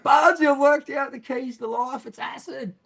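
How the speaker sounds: high, excited retold shout; yelling to neighbour. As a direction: call out a gleeful discovery in a high voice. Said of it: "I've yelled out to me neighbour".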